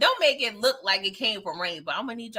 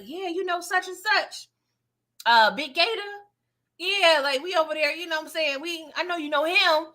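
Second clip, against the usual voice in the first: a bit higher, eager chatty name-dropping; a hint of trying too hard to relate; lightly voiced name-dropper; 5 semitones higher than usual. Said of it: "She was like".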